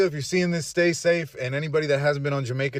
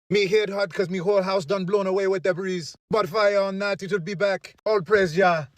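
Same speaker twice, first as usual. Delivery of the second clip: higher-pitched playful Jamaican patois impression; a cheerful imitation of a Jamaican beach streamer; mock Jamaican accent; 3 semitones higher than usual. Fan imitates Jamaican livestreamers on the beach, in a cheerful mock Jamaican accent. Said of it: "I fucking love the accent".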